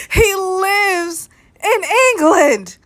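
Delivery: very high, slow "He lives in England"; exasperated, word-by-word "He lives in England"; incredulous emphasis. Real, not acted. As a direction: draw out "lives" hugely, then land on "England". Repeats it in amused disbelief.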